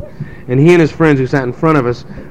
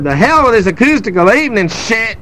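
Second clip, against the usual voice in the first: defiant singer; 7 semitones higher than usual. Retold or imitated. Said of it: "she said".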